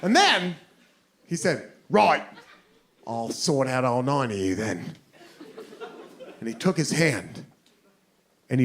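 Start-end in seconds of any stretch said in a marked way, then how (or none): 1.9-4.9 low British threat, dropping softer; mock British accent. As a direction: menace them quietly in a British accent. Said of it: "very drunk British guy".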